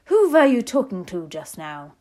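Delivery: loud, snappish witch demand; witch snapping. A narrator's reading. Grand High Witch questioning grandmother, snapping loudly. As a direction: snap it out loudly, imperious. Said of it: "snapped the Grand High Witch".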